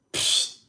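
bad imitation of a can hiss; mock a can popping open; beer can opening hiss. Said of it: "the worst impression of a beer can opening".

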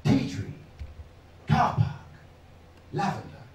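fading whispered words; descending volume. Retold or imitated. Comic imitating the audiologist's fading test words, whispered. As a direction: whisper each word fainter. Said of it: "Again, in descending volume".

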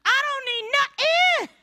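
high, slow, loud boastful sass; smug testimony. Pretend. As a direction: show off a carefree blessed life. Same smug churchgoer.

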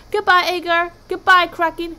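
high, loud goodbyes; called farewell. A narrator's reading. Viking leaving sea god and Kraken, calling out. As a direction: call out each goodbye brightly.

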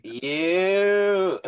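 one long, rising, high-pitched holler; crazy fan whoop. Shown as a demonstration. Audience member showing how he cheers Belfast performers, whooping wildly. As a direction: whoop it out loud, stretching the vowel. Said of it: "Oh, I go crazy".

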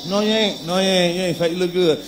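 low, soft mock reassurance; lightly acted, hushed denial; reassuring husband. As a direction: play a husband quietly soothing his wife. Husband reassuring wife in acted exchange.

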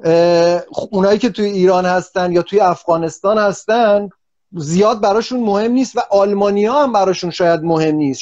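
ordinary delivery

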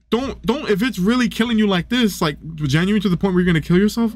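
usual speaking voice

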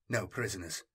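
ordinary delivery, nothing marked

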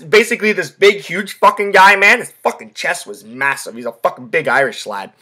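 ordinary talk, nothing marked

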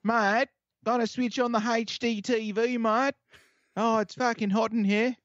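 high, hammed-up Australian accent; mock Australian accent with "mate". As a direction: play a broad Aussie bloke. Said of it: "Ask an Australian".

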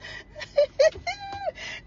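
high laugh. Real, not acted. Mother laughing at her own story.

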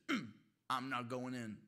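low, quiet sulky refusal; mock pouting. Preacher as the elder son refusing the party, mock pouting. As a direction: dig in with a hushed, acted pout. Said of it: "And he starts pouting".

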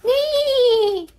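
high, loud neigh; whinny like a horse. A narrator's reading. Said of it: "a horse's whinny".

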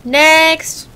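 brisk official call-out; calling the queue. Acted. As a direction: summon the next in line loudly. Calls next traveler to booth.

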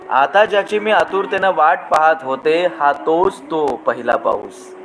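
lingering, lilting recital of verse; poem recitation. Acted. Host reciting a rain poem to his audience, lilting. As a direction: savour each line like falling rain.

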